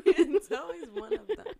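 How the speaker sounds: laughing. Real, not acted. Laughing agreement, loud.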